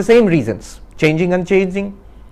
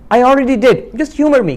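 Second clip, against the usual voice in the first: high, louder weary protest; exasperated friend; 8 semitones higher, 4 dB louder than usual. Acted. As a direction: push back on the pointless recount. Exasperated friend told to recount.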